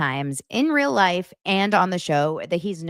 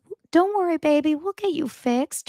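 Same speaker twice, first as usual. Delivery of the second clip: high soothing coo; wife reassuring her sick husband, high and tender; tender mom; 11 semitones higher than usual. Retold or imitated. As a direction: comfort him like a loving mom. A mom that says.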